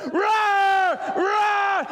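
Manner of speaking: high drawn-out roaring yell; lion roar shout. Acted. Preacher voicing the beast's roar at the sheep lion, long shout. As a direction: roar it like a lion across the river.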